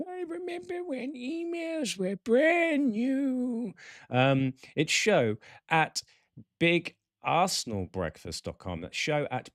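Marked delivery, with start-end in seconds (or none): 0.1-3.7 high, slow, put-on old codger voice; old-timer voice. Podcast host mocking his own age as an old codger. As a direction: croak it out like an ancient grandad remembering.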